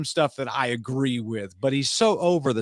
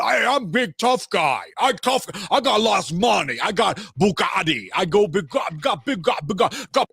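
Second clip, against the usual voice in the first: Andrew Tate impression; 7 semitones higher than usual. Mocking Andrew Tate to his audience, in a high shouted caveman voice. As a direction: puff up and boast like a dumb brute. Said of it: "It's actually a pretty solid impression".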